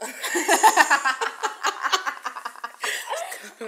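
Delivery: loud, high burst of real laughter. Real, not acted. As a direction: crack up at her friend. Friend teases, both laughing.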